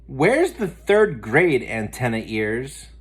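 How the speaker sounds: put-on a spoiled girl's taunt; snooty tease. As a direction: look down your nose at him. Muffy teases Arthur.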